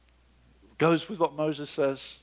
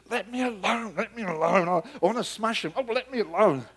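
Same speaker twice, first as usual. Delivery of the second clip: God begging to be left alone, voice raised higher; a mock-pleading God, higher pitch; 5 semitones higher than usual. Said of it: "God says".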